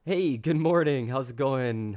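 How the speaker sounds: normal talking voice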